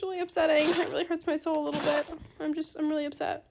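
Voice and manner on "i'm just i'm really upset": ordinary delivery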